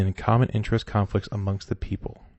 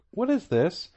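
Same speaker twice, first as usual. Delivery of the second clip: amazed scientists; 6 semitones higher, 4 dB quieter than usual. Retold or imitated. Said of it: "They're like".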